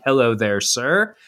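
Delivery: normal talking voice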